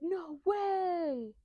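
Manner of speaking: put-on high, amazed kid voice; Luffy. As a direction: marvel like an awestruck little boy. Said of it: "Luffy's like".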